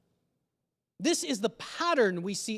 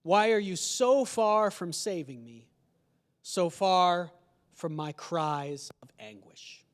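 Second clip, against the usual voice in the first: slow, grave reading of the psalm; a preacher reading the opening verse aloud; solemn scripture reading; 4 semitones lower, slower than usual. Acted. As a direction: read it like a lament, slowly.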